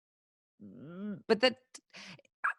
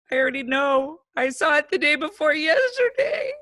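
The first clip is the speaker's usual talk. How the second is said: imitating a child's high, wailing tears; mock-sobbing little kid confessing, voice pitched up; tearful kid; 9 semitones higher, 5 dB louder than usual. Stepdaughter confessing to stepmom, imitated tearful breakdown. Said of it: "instant tears".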